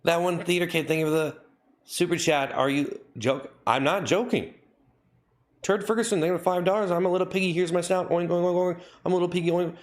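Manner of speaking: high, squeaky little-pig voice with oinks; piggy voice. Acted. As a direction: play a silly piglet thanking donors. Mock theater-kid piggy voice.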